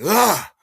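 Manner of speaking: a short loud angry yell; frustrated yell. Retold or imitated. Storming off after the cab leaves him, loud frustrated yell. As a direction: let out a furious loud ah.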